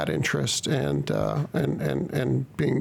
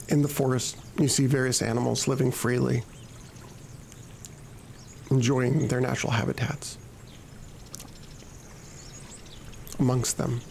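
hypnosis voice; 4 dB quieter than usual. Acted. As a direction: lull the listener into calm, unhurried. Resumes the hypnosis.

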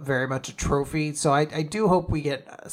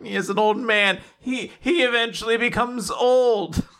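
high, hammed-up jeering; mocking crowd; 6 semitones higher than usual. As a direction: imitate people making fun of someone. Everyone just laughs.

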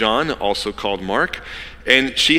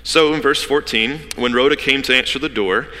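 normal talking voice

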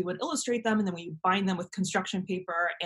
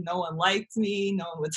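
normal talking voice

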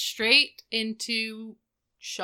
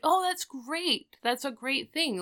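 mildly hammed-up delight, pitched high; acted approval; 6 semitones higher than usual. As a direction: praise it in a higher, stretched voice. Retold friend approving the project.

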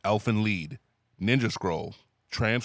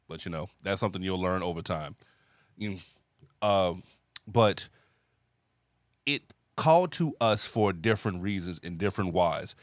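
ordinary talk

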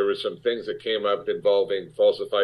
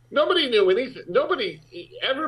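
exasperated outburst; faster than usual. Real, not acted. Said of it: "indignant emphasis".

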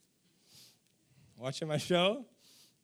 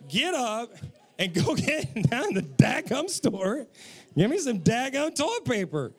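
louder, hammed-up exasperated order; mock irate wife; 3 semitones higher, 3 dB louder than usual. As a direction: act out the wife's unspoken demand with comic force. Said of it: "what was she saying?".

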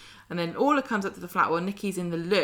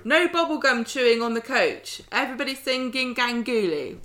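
raised, higher coach-trip teacher voice; bossy trip organiser; 7 semitones higher, 3 dB louder than usual. Acted. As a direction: call out orders to a busload of pupils. Acting Daniel running a school coach trip.

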